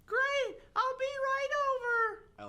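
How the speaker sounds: very high, drawn-out mock-female reply; sing-out falsetto, held long; put-on falsetto character. Alex answering Steve's call, high falsetto.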